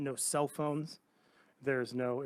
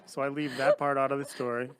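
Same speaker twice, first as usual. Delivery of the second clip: breaking into laughter mid-sentence; real amused laughter; laughing while talking; 11 dB louder than usual. Real, not acted. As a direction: laugh through the words, louder. Laughs at own story.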